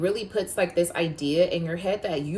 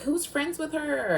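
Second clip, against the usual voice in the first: mocking classmates; 11 semitones higher, slower than usual. Mocking classmates at lunch table.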